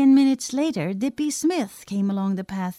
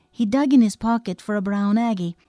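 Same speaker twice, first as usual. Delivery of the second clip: usual speaking voice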